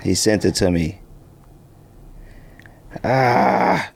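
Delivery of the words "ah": ordinary talk, nothing marked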